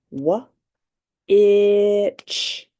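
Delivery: Wha- then a loud itch; sounds pounded apart. Shown as a demonstration. Teacher pounding sounds for the class, loud end. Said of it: "pound in sounds".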